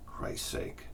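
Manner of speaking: exasperated whispered aside; fed-up whisper to yourself; whispered curse. Real, not acted. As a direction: breathe the curse out under your breath.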